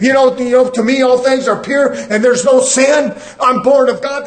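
loud, driving sermon delivery; preaching at full force. Acted. Preacher exhorting the congregation, loud and driving.

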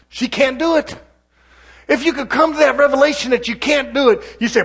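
full-force preacher's cry; preaching at its peak. Acted. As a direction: shout the point to the congregation. Preacher hits a climax.

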